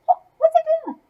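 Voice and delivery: put-on high, puzzled kid voice; baffled children staring at Pete; confused kids.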